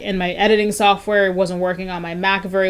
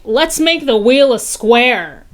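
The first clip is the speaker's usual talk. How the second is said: slow, mock-earnest trainer pitch; mock super trainer; 5 semitones higher, 6 dB louder, slower than usual. Mocking fitness trainers with a slow sales voice. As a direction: spell out the silly idea slowly. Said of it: "They're really like".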